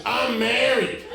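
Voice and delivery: high-pitched mock female reply; imitated woman driver. Woman driver to a stranger at her window, high imitated reply. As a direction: play the startled woman turning him down. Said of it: "all she said was".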